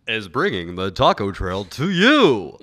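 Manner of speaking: loud, slow booming announcer. Pretend. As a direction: boom it out like a TV host. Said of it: "do an announcer voice for that".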